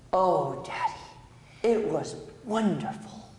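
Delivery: put-on slow, swooning little-girl gush; lightly voiced daughter overdoing her delight; real dramatic. Daughter telling dad about the writing class, slow and dramatic. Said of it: "Real dramatic".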